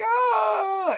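high-pitched startled "ah"; startled yelp. Retold or imitated. Kazuya startled by grandmas.

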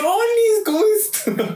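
chirpy high-pitched telephone hello; phone voice. Shown as a demonstration. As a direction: switch into a sugary high phone voice. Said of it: "a ustedes les cambia la voz".

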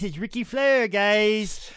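hammed-up Nature Boy brag; Ric Flair impression. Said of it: "Ric Flair impersonation".

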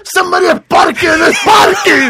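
rising into a high shouted complaint; building from a mild rant to a shrieking yell; angry accented rant. Retold or imitated. Comedian acting out an angry man's parking complaint, building to a shout. Comic bit voicing angry man.

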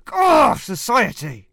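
loud, slow, despairing wail; anguished scornful cry. Acted. Roman satirist wailing at society, loud and slow. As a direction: lament society like a tragic ranter.

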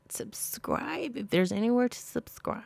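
softly voiced, half-joking whisper; a hushed throwaway plea; whispered aside. Real, not acted. To podcast listeners, a whispered throwaway plea.

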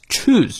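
"choose" given full, drawn-out weight; stress on CHOOSE. Shown as a demonstration. Teacher marking sentence stress, "choose" stressed. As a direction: lean on the word "choose". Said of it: "choose重读".

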